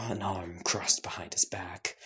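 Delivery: plain reading voice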